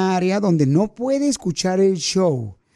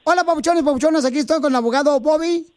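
higher, upbeat radio spot; ad read; 5 semitones higher than usual. Acted. Host recording the lawyer's commercial, upbeat. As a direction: sell the lawyer to the audience.

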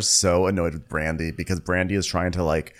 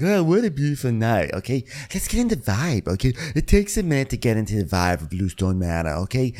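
lightly put-on, lilting hostess voice; Dorinda impression; 3 semitones higher than usual. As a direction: ease into a swooping, savoring hostess. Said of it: "Dorinda's telling us, like".

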